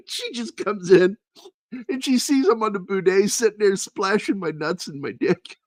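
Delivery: high voice bubbling with laughter; giggly, high retelling; laughing while telling. Real, not acted. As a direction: tell it while cracking up. Laughing through the story.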